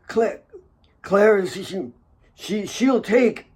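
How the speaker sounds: put-on high, halting old man's voice, broken by wheezing; dying father. A narrator's reading. Dying father speaks to his daughter Kate, halting and wheezing. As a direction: speak in weak spurts, catching each breath. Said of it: "He spoke in spurts, interrupted by wheezing".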